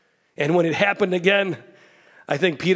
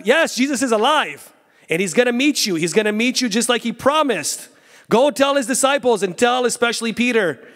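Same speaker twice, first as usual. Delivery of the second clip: raised, higher, ringing proclamation; fervent preaching; 6 semitones higher than usual. Acted. Preacher tells young people Jesus is alive, fervently. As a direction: lift the voice in joyful announcement.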